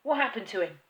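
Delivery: loud alarmed splutter; the father spluttering in alarm. Acted. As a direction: blurt it out in alarm. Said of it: "he spluttered".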